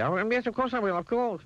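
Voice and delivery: high, flustered consent; hurried agreement. A narrator's reading. Mister Mean agreeing to fetch the ball, hurried. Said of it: "he said hurriedly".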